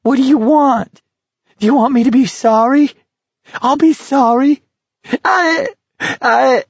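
loud, very high desperate wail; frantic high-pitched cry breaking down; hysterical pleading. A narrator's reading. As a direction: screech it, begging, near tears. Whining builds into hysterical pleading.

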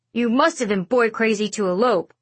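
lightly voiced cranky grandfather; grumpy old man. A narrator's reading. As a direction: needle her like a cranky grandpa. Grandfather needles mom.

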